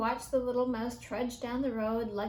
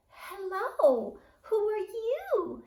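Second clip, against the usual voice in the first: put-on young mouse voice; 8 semitones higher, 4 dB louder, much slower than usual.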